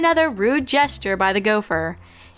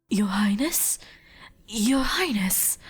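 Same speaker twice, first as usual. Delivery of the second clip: soft whispered call; whispered handmaiden; 5 dB quieter, slower than usual. Acted. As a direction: gently rouse her. Servant calling dreaming princess.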